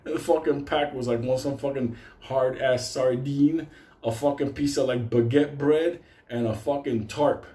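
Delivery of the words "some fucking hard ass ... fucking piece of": ordinary talk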